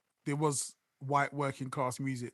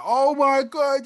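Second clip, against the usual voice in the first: high, loud, slow gushing oh my God; drawn-out mock gasp of delight; mock excitement; 10 semitones higher, 9 dB louder, much slower than usual. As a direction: ham up being wildly impressed. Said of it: "Shouldn't you be more like".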